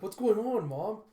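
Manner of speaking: put-on high, loud frightened little boy; a child panicking, asking his mom; scared child. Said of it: "And Andy's like".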